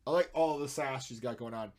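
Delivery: ordinary talk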